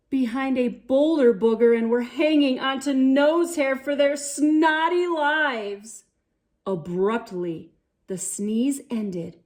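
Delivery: storybook narration voice; reading to children. A narrator's reading. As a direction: read it like a bedtime picture book. Children's story read aloud.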